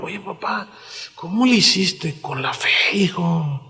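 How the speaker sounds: softly asking his father, almost under his breath; hushed son to father. Retold or imitated. Isaac asking father Abraham about faith, hushed and soft. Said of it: "yendo con Abraham".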